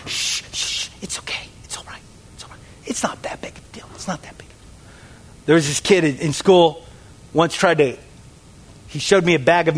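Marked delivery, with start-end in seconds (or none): 0.1-4.5 whispered hush; hushed soothing. Acted. Excusing our own disobedience, soothing whisper. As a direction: whisper it away as if soothing a guilty conscience. Hushing away guilt about sin.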